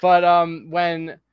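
usual speaking voice

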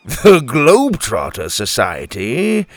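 loud, chuckling disbelief; pirate captain amused and incredulous; amused surprise. A narrator's reading. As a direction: repeat it back as a scoffing question. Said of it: "he chuckled".

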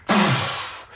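blown puff of rushing air; imitated wind gust. Game master voices wind hitting the caravan, blown gust. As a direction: blow out a sudden gust.

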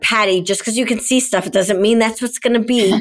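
feisty protest, retelling own words. Retold or imitated. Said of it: "feisty, shary way".

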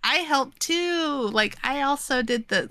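put-on little-kid voice; imitated kid. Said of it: "she's a kid".

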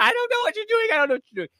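high mock frightened cry; retold scream. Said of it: "like, screaming".

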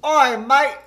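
put-on loud, high Aussie accent; shouted mock-Australian greeting. Player giving an accent clue, loud Australian accent.

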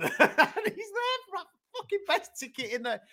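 high laughing mock voice; laughing impression. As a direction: ham up a lazy bloke egging them on. Mimicking the fighter standing idle.

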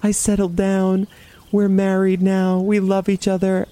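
reliving it, half-crying the words; retold crying. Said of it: "And then I just start crying".

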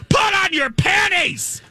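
very high, mock-furious shriek; imitated screaming. Imitating her scolding him on the phone, screaming. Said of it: "She's, like, screaming".

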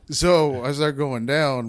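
ordinary talk

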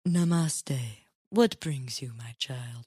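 mock mystic guru. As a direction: tease it like a cliché spiritual healer. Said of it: "I figured she'd be like".

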